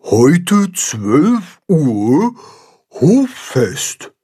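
slow, high announcement with drawn-out words; reading out. A narrator's reading. Baldrian reads the sign to all the animals, slowly proclaiming. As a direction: read the sign aloud very slowly. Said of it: "Und Baldrian liest vor".

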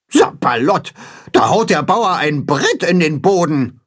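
mild indignant goose voice; grumbling goose. A narrator's reading. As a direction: rant like a cross old goose. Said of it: "wettert Grimm".

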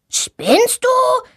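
very high indignant shout; yelling. A narrator's reading. Boy yells at the girl who fell on him, very high. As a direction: snap at her as she lands on him. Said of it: "schreit er sie an".

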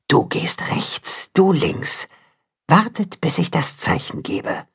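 wolf mother whispering orders, slow and hushed. Acted. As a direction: breathe the commands to her pups. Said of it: "Da hört er die Wolfsmutter flüstern".

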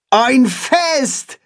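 excited call. Acted. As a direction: call it out, thrilled. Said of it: "ruft Holstein".

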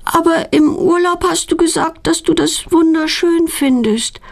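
put-on high-pitched child's objection; protesting girl. A narrator's reading. Daughter reminding mother what she said on holiday, high protest. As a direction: argue back in a high girl's voice.

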